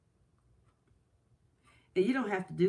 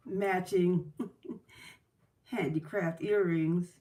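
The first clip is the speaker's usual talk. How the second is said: ordinary talk, nothing marked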